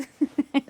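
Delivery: amused burst of laughter; laughing. Real, not acted. The light system fails.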